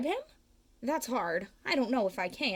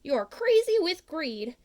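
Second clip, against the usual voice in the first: louder, higher scolding; angry Lorax; 8 semitones higher, 5 dB louder than usual. Acted. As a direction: scold him for his greed. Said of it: "The Lorax said".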